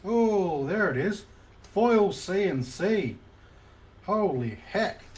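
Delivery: thrilled, loud burst of delight; excited. Real, not acted. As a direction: blurt it out, real joy at a rare pull. Rare card pulled on stream.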